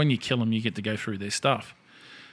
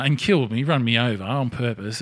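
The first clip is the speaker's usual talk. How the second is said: normal talking voice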